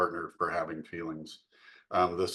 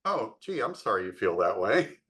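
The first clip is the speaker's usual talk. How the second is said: mock apology. Pinching partner feigning sympathy, mock apology. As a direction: fake innocent sorry with a laugh in it. Acts dismissive partner, laughing.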